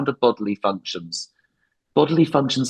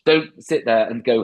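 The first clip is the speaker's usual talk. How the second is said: ordinary talk, nothing marked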